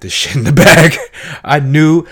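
loud laughter through the words; laughing. Real, not acted. Reviewer laughs retelling Obama on Palin, loud laughing. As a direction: crack up while saying it.

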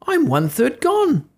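high, slow, mournful little voice; gingerbread man voice. A narrator's reading. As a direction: wail it slowly like a tiny cookie. Gingerbread man being eaten.

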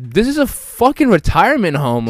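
high, loud retold outburst; mock indignation. Friend retells his silent reaction to the man, mock indignant. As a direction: protest in disbelief, half laughing.

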